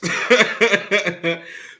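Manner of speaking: a quick chuckle at your own tangent; laugh. Real, not acted.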